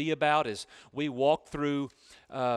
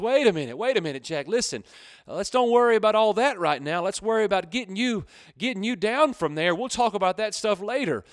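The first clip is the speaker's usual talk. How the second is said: interrupting; 5 semitones higher, 4 dB louder, faster than usual. Acted. God cuts off the bargaining.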